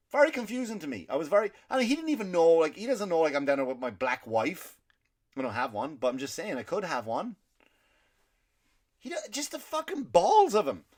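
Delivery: high, loud indignant venting; climbing in disbelief at his nerve; outraged rant. Real, not acted. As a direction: still fuming about the dealer, high and loud. Outraged over the dealer's remark.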